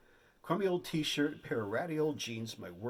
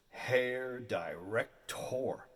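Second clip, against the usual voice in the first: ordinary talk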